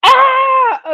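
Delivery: high, loud burst of laughter; laughing shriek. Real, not acted.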